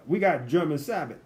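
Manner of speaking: "Sabbath" standing in for "shepherd"; Alabama pronunciation. Retold or imitated. Alabama family telling about their dog, shepherd mispronounced "Sabbath". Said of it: "they couldn't say the word right".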